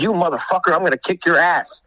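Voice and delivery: high-pitched mock threat; retold angry threat. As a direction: imitate a worked-up hothead threatening a fight. Retold threat during confrontation.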